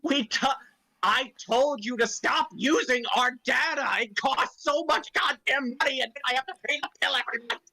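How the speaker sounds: high, exasperated nagging; petty rant. Acted. The Emperor nags about the data bill. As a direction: complain like a dad paying the bill.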